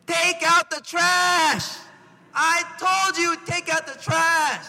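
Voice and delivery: loud, high, drawn-out shout; exasperated parent bellowing each word; parent's yell. Acted. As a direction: yell slowly at a child who ignored you. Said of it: "you, you yell".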